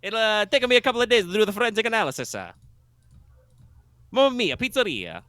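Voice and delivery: high, loud hammed-up Italian accent; over-the-top Italian accent, pitched high; outrageous Italian accent. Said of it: "from being outrageously Italian".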